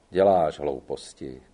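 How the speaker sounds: put-on a stern old gendarme speaking slowly; slow, low reproach. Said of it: "řekl pomalu".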